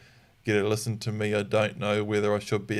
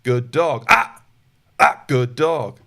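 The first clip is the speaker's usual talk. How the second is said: loud slow "Ah" then warm "good dog"; loud "Ah" turning into praise; quick switch correction to praise; 7 dB louder, slower than usual. Shown as a demonstration. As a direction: snap from pressure straight into praise. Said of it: "Sometimes it looks like".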